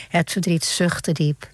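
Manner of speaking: usual speaking voice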